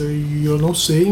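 usual speaking voice

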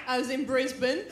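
ordinary talk, nothing marked

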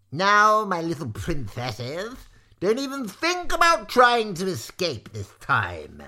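high, loud, menacing relish; gloating villain. A narrator's reading. Maudmont warning the caged girls, high gloating threat. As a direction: crow over the captives with relish.

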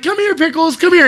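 very high, sing-song call to a pet; high coaxing voice. Retold or imitated. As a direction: coo at it like calling a puppy. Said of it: "you're like, you're like".

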